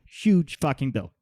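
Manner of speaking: ordinary delivery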